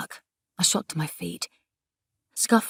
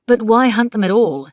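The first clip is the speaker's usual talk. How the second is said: insistent challenge, higher and louder; urgent, indignant pushing question; pressing; 5 semitones higher, 10 dB louder than usual. A narrator's reading. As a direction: press him hard for an answer. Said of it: "she pressed".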